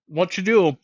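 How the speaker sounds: talking through a mouthful of food; words round a bite of steak; mouth full. Real, not acted. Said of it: "I'm just gonna talk with my mouth full".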